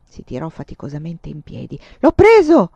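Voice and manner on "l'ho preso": high, loud triumphant cry; shout. A narrator's reading. Lord shouting to the crowd he has caught him, loud cry. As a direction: yell it out to the crowd. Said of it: "urlò Talento Nobile".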